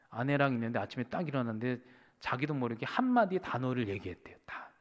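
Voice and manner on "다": ordinary delivery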